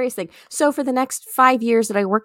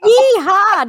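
high, loud country yeehaw; mock yeehaw; 13 semitones higher, 7 dB louder than usual. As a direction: holler it like an old country comic. Evoking Minnie Pearl's comedy.

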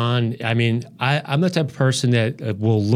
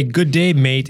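mock Australian greeting, higher and louder; Australian accent; 4 semitones higher, 6 dB louder, slower than usual. Shown as a demonstration. Host showing guest the Australian accent to copy, Australian accent. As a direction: say it with an Australian lilt. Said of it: "in an Australian accent".

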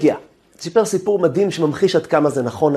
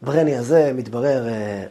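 plain reading voice

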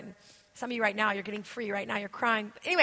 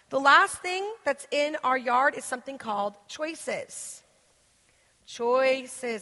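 choices set apart, slow and falling; slow repeat of "Choices"; stress on choices; slower than usual. Real, not acted. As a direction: land on "Choices." alone. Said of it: "Everybody say choices".

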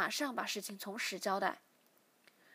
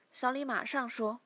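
usual speaking voice

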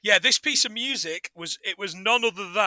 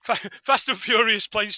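usual speaking voice

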